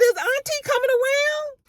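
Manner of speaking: very high, playful teasing; teasing surprise. Retold or imitated. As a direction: squeak it out like you can't believe it. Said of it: "I said".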